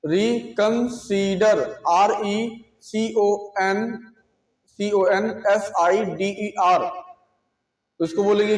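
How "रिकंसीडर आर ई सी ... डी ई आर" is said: reconsider spelled very slowly, letter by letter; slow spelling. Shown as a demonstration. Teacher slowly spelling reconsider for students. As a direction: drag out each letter of reconsider. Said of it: "क्या बोलेंगे".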